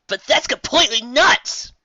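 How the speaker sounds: loud exasperated pony; outraged yell. Acted. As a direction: hammer home her outrage. Rainbow still outraged.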